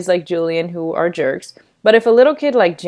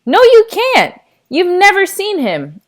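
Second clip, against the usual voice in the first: insistent protest; 12 semitones higher, 5 dB louder than usual. Acted. Jack insisting to Mom.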